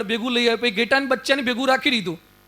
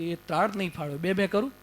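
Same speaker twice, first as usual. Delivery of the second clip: timid lamb voice; 4 semitones lower, 9 dB quieter than usual. Acted. Cub meekly answers the lion, in a small, much softer lamb voice. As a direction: lower and much softer, meek little voice.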